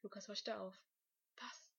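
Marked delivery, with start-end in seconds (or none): 1.4-1.7 very soft, hushed question; whispered. A narrator's reading. Boy answers his classmate during maths class, whispering. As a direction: whisper back without being caught. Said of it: "flüsterte er zurück".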